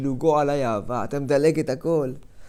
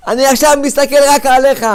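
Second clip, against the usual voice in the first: much higher and louder, booming divine call; loud proclamation; 9 semitones higher, 10 dB louder than usual. Acted. God telling the person he watches only him, loud and high. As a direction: announce it like a voice from above. God's voice in door image.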